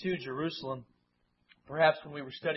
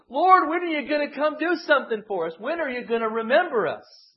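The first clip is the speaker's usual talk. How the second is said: higher, louder pleading cry; raised, higher lament to God; pleading nation; 8 semitones higher, 7 dB louder than usual. Retold or imitated. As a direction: cry out to the Lord like a downtrodden people. Voicing the downtrodden nation's plea.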